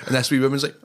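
ordinary delivery

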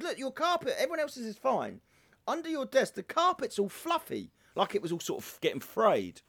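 high-pitched mock telling-off; imitated fussy boss. Boss telling Paul off about his fluffy carpet, mock fussy. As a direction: pick at him in a high imitated voice. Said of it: "She goes".

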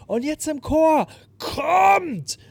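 hoch, laut und langsam gerufen; leidenschaftlicher Bühnenruf. Retold or imitated. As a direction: ruf die ganze Menge mit voller Kraft zum Mitsingen. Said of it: "geht so ein richtiger Blitz durch ihren Körper". (Sängerin fordert das Publikum zum Chor auf, laut und hoch)

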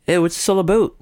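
high, goofy Canadian "aboot"; bad Canadian accent voice. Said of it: "Those are the worst Canadian accents".

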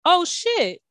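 high, sharp yelp of alarm; shocked cry. Retold or imitated. Host retelling seeing herself go viral, shocked yelp. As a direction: react like you just saw the video. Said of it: "I'm like".

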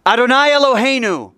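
with gusto. Shown as a demonstration. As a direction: lead the congregation in a full-voiced prayer call. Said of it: "say it with some gusto".

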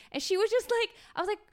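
normal talking voice

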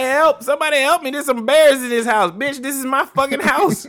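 high, loud fake-victim cry; mock the intruder playing victim; mocking Goldilocks. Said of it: "Bitch is like".

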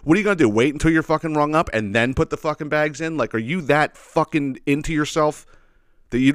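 normal talking voice

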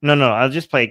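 ordinary talk

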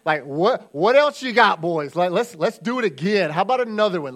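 loud, cocky showing off; shouting like a swaggering champ; mock bragging. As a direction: hype up the boys after a big win. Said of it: "that would have been my response".

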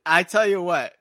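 gasping through a burning mouth; hot-sauce burn, talking through it; mouth burning from spicy food. Real, not acted. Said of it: "my mouth is burning".

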